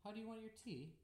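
"How do you want your tea?" high, then falling; English down inflection. Shown as a demonstration. Cohost trying the English question, "tea" falling. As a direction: start high and drop the pitch on "tea". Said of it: "in England, you would say".

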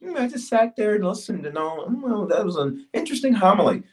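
lightly voiced, smug churchgoer; bored churchgoer. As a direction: hum and shrug it off like a bored listener. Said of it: "you are not gonna just".

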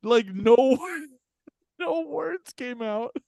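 words squeezed out high between laughs, slow; can barely get it out for laughing; laughing through it. Real, not acted. Telling friends how Gio froze, laughing hard.

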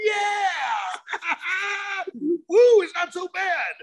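high, laughing whoops; excited cheer. Retold or imitated. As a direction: celebrate a tiny spark. Cheering at little fire.

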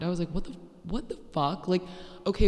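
ordinary delivery

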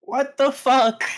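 high, loud, slow-stretched disbelief; exasperated outburst. Acted. Cecile argues back at Kirsten, exasperated outburst. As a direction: draw every word out in loud outrage. Acting Cecile's outrage on comm.

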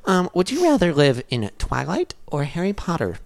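high kiddie voice asking a silly question; twelve-year-old voice. Retold or imitated. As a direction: play a goofy preteen. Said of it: "run by twelve-year-olds".

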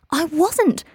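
high, loud protest; indignant denial. A narrator's reading. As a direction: deny it hotly, voice leaping up. Ron protests the accusation.

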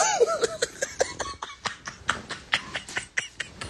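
breathless silent wheeze of laughter; high wheezing laugh. Real, not acted. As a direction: crack up without voice. Laughing at own punchline.

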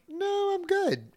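high, slow, soft mock dog reply; imitating an unbothered dog; dog's voice. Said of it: "but he was like".

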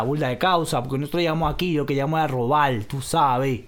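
put-on boastful Caracas hoodlum accent; thick Venezuelan street slang delivery; Petare malandro. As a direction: play a swaggering barrio thug. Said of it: "Como malandro de Petare".

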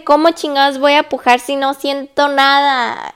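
mock outcry; retold exasperated protest. Acts out her exasperated question.

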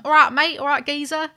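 hammed-up Cockney market-trader voice; Cockney geezer greeting, played for laughs; Cockney greengrocer. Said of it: "you were saying that I'm like a greengrocer".

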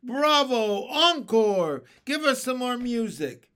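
loud, high cries of acclaim; an enthusiastic audience shouting out; shouted cheer. A narrator's reading. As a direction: cheer loudly for an encore. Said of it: "stood up and shouted".

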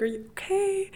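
high-pitched imitation of worried girls; mock-concerned girl voice; concerned stranger. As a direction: check gently on someone, voice lifted high. Concerned strangers approach her, acted.

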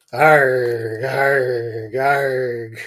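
loud barked animal noise; barking arg. Acted. The boy with no words barking at his brother, loud. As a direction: bark it out like a dog. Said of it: "barked Richard".

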